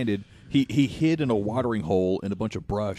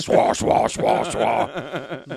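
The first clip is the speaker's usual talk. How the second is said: mock demon voice; 3 dB louder, much slower than usual. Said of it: "they don't do the demon voice".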